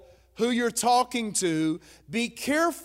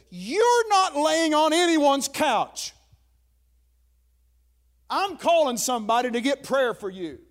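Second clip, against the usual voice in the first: imitating an emphatic wife, pitched up; firm wifely refusal; 5 semitones higher, 3 dB louder than usual. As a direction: put your foot down as the exasperated wife. Wife firmly refuses his panicked idea.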